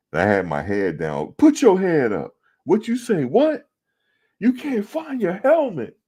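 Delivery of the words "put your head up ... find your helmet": very high, incredulous coach yell; mock coach screaming. Coach raging at player over a lost helmet, high mock scream. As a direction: shriek it like a coach near a stroke. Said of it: "I thought he was gonna have a stroke".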